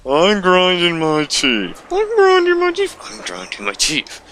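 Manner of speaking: very high, slow squeaky gritted voice; hammed-up grind through the teeth. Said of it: "grinding their teeth".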